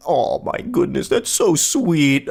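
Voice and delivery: slow, swooping sarcastic coo; mock gushing. Host reacting to creepy love text, sarcastic swooning. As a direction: pretend to melt, drawing it out.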